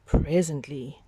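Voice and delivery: plain reading voice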